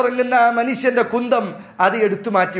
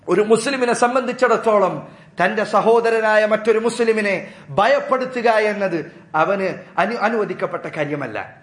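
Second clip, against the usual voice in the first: ordinary talk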